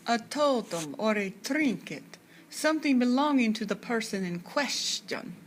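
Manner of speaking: husky mock Hungarian accent, slowed right down. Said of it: "Miss Sadie's Hungarian accent, making my voice thick and husky".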